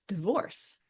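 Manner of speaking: ordinary talk, nothing marked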